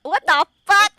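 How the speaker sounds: loud, shocked yell; real shock. Real, not acted. Reader stunned by what was just read aloud, shocked yell. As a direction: yell it in disbelief.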